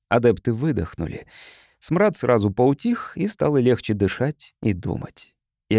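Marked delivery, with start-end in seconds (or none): none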